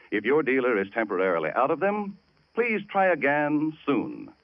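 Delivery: usual speaking voice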